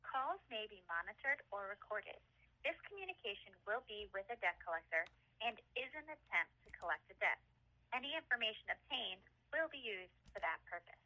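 phone menu voice. Acted.

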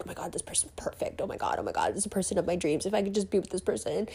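hushed, breathless gushing under the breath; infatuated whisper. Acted. Besotted dater daydreaming about the perfect person, rushed soft whisper. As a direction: whisper it quickly, as if swooning in secret. Acting out someone secretly infatuated.

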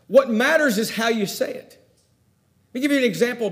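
ordinary talk

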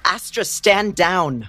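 loud, high shout to call off the owl; a sudden loud command to a familiar. Acted. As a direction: order the creature to stop, slowly and sharply. Calls off her owl familiar.

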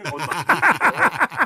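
high, slow yelp; pained yelp. Real, not acted. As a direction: stretch it out high and loud. Face scrubbed with nail polish remover.

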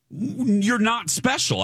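slow, high-pitched put-down; imagined comeback, slow and pointed; scornful retort. Retold or imitated. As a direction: draw it out with acted disdain. Imagined retort to the queue jumper.

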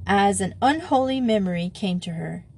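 ordinary delivery, nothing marked

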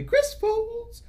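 very high falsetto squeak; silly high voice. Pretend. Host thanking a sponsor by name in a comic falsetto.

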